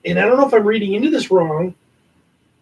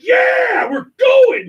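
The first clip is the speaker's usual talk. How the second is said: mock yell, much higher and eager; excited shout; 14 semitones higher, much slower than usual. Hosts recalling their reaction to last year's con, excited yell. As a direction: shout it like an overjoyed fan.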